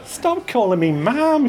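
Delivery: light rebuke. Acted. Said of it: "with a light rebuking slap".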